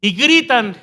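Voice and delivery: half-mocking ecstatic church shout; mock euphoric preacher. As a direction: imitate a pastor whipping the crowd into a frenzy. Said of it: "el pastor se llena de euforia".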